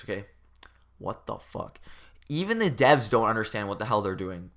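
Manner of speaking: ordinary talk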